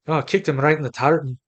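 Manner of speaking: a light stab at a Scottish accent; half-hearted Scots brogue on a joke. Shown as a demonstration. Host jokes to cohost in a light Scottish accent. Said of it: "I'm not good at Scottish accents".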